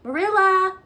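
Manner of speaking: high, loud call up the stairs; plaintive call. A narrator's reading. Anne calling Marilla over the banisters. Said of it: "a plaintive voice calling".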